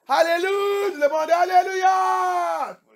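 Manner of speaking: high, loud, drawn-out alléluia; church shout. Retold or imitated. Preacher imitates churchgoers crying out alléluia, loud and slow. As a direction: bellow it like a fervent worshipper. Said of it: "tu cries".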